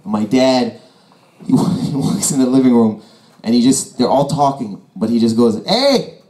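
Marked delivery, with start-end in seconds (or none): 5.7-6.2 high-pitched mock hail; calling out. Dad interrupts cousins talking in living room, high call-out. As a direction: imitate dad calling for attention.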